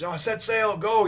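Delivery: high urgent shout; urgent call. Retold or imitated. Nami running up, calling high to the crew to leave.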